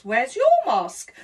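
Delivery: usual speaking voice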